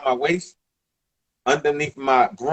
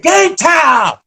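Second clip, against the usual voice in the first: high, loud hyped shout; screaming; 13 semitones higher, 6 dB louder than usual. Retold or imitated. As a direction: yell it like you own the gym. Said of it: "I'm screaming".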